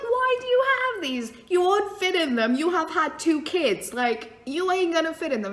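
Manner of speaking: high, scoffing teasing voice; high mocking disbelief at the aunt; mocking incredulity. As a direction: tease her about the old clothes. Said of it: "And I'm like".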